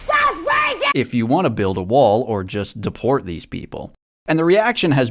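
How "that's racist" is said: high shriek of outrage. Retold or imitated. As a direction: screech it like an offended liberal. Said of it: "that scream".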